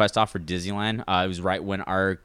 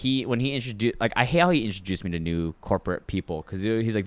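ordinary talk

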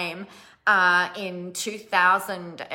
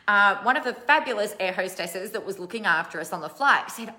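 ordinary talk

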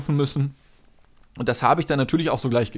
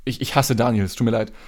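ordinary talk

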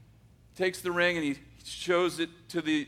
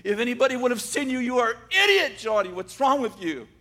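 scolding himself; 5 semitones higher than usual. Retold or imitated. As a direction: berate yourself all the way home. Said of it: "he says to himself".

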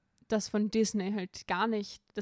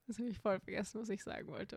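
trailing off; 7 dB quieter, faster than usual. Real, not acted. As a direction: voice fading away to nothing. Lost her train of thought.

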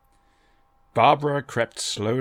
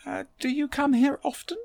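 much higher, breezy polite small talk; lightly airy. A narrator's reading. Barbara tries polite chatter with the Daleks, airy and much higher. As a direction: toss it off casually to fill the silence. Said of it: "she said airily".

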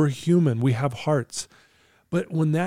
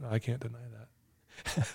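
hushed slow admission; 2 semitones lower, 12 dB quieter, slower than usual. Retold or imitated. Client conceding, retold.

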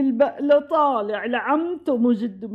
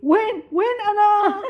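high, disoriented outburst on waking; high-pitched panicky confusion; startled awake; 8 semitones higher, 4 dB louder than usual. Acted. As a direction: jolt out of sleep, bewildered.

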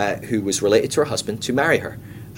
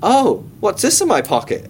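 mock innocent surprise; 8 semitones higher than usual. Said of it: "she goes".

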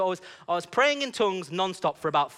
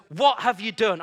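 higher, louder acted outcry; astonished demand; 5 semitones higher, 6 dB louder than usual. Woman to the man who prayed, astonished. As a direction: demand an explanation, half alarmed. Woman confronting him, retold.

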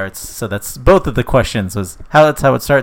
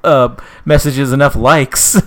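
louder, higher mock exasperation; Jesus; 5 semitones higher, 4 dB louder than usual. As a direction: play-act a peeved complainer. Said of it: "And then Jesus is like".

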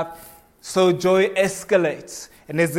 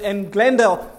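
ordinary delivery

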